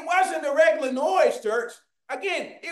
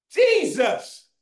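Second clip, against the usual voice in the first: raised, carrying cry out to Jesus; imitated shout; 4 semitones higher, 4 dB louder, slower than usual. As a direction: call out over a crowd, higher than usual. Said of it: "So he began shouting".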